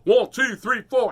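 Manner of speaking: loud count-in; loud bandleader count-in. Acted. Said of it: "counting in a song".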